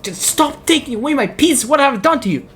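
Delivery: high pleading whine; whiny high voice. Retold or imitated. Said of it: "Fan is like".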